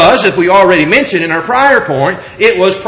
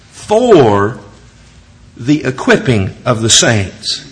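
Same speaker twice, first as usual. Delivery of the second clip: lower, measured reading of the verses; scripture reading; 6 semitones lower than usual. Acted. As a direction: read the passage like holy text to the congregation. Preacher reads Ephesians passage aloud.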